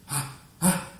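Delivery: sharp high gasp of mock panic; mock panicked gasp. Girl gasping in panic at no reply, a mock gasp.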